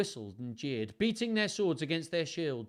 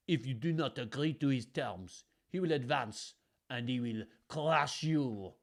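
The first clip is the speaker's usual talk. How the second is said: put-on lightly accented French threat; a touch of scorn in a French voice; scornful French messenger; 3 dB louder than usual. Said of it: "The messenger declared scornfully".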